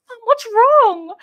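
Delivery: put-on high, loud, slow child's worry; high little voice, worried about mum; worried daughters. Daughters asking their crying mum, in a high, loud, slow voice. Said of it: "my girls were like".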